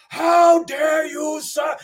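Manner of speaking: high, drawn-out mock shout; imitated outraged dad. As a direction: bellow it slowly like an offended father. Angry dad follows comic out.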